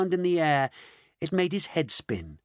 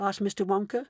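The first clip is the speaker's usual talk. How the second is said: usual speaking voice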